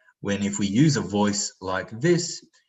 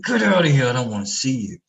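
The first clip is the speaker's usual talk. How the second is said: much higher, louder angry yell; angry shout; 5 semitones higher, 6 dB louder, faster than usual. Retold or imitated. Abusive caregiver yelling at a child to leave. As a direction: throw them out with a harsh yell. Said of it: "someone saying".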